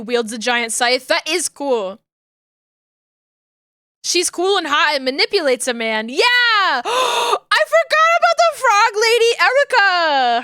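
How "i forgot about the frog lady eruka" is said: high, loud gasping shriek; shrieking jackpot winner. Real, not acted. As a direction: shriek like you just won. Sudden excited recognition.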